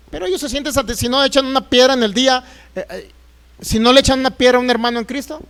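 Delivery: ordinary delivery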